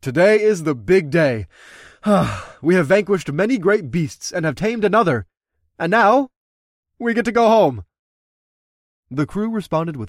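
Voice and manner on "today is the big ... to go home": rousing speech. Acted. Leader addressing crew.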